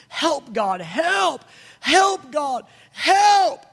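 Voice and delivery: rising into a high, loud desperate yell; yelling for help. Retold or imitated. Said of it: "And I'm yelling".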